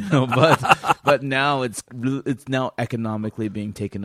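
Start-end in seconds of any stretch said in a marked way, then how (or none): 0.0-1.8 laughing while talking. Real, not acted. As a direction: laugh through the words. Laughing during apology exchange.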